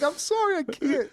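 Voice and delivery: very high, squeaky giggling apology; high-pitched mock plea, helpless with giggles; imitated helpless laughter. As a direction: act out laughing till you cry. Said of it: "on the ground crying, laughing".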